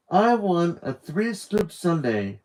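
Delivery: slow, high half Southern drawl, half upper crust British; a hint of a Southern-British lady; Southern-British accent. The perfumed woman ordering at the counter, Southern-British accent. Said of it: "in a strange accent".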